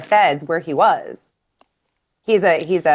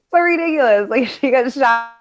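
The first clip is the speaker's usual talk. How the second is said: high-pitched, laughing disbelief; laughing while talking; 6 semitones higher than usual. Real, not acted. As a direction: tell it through laughter, voice lifted. Laughing at absurd story.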